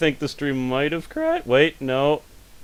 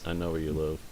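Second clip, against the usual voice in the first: low creepy phone stalker voice; heavy breathing phone whisper; 9 semitones lower, 13 dB quieter than usual. Pretend.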